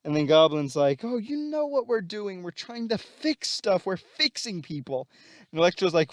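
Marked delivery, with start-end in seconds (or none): 1.0-5.1 high, drawn-out villain voice; high, slow mock explanation; Goblin. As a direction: pitch it way up and stretch the words. Voicing Goblin answering Electro.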